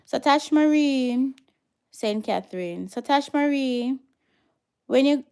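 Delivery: loud, high call to a viewer; loud call to a viewer to come forward. Real, not acted. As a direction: summon her from across the room. Calling out to viewer.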